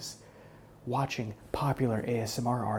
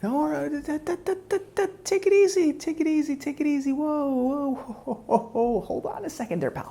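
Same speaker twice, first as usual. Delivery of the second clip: pitched way up, calming a panicking captive; mock-gentle, high and loud: easy, easy; high soothing hush; 14 semitones higher, 4 dB louder than usual. Calming the struggling victim.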